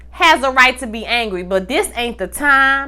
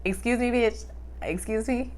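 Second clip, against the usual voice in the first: soft, incredulous excuse me; quietly affronted, dripping attitude; offended clapback; 14 dB quieter than usual. Retold or imitated. Nova answers the ex-wife, quietly affronted.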